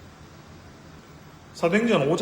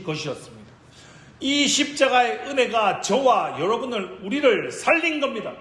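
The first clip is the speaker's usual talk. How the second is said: high, impassioned sermon proclamation; full-force preaching; 6 semitones higher, 5 dB louder than usual. Real, not acted. Preacher declares victory of the cross, full-force preaching. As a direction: proclaim it with rising fervour to the congregation.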